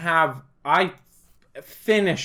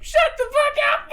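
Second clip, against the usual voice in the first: very high squealing mock mom; drunk mom. Drunk mom yelling at her boyfriend, high squeal. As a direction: squeal it like a tipsy mother.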